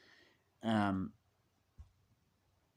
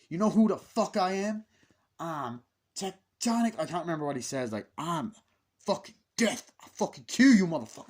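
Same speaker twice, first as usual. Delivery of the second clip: higher, louder tough-guy threat; menacing threat; 5 semitones higher, 6 dB louder than usual. Retold or imitated. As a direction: swagger and threaten to kill.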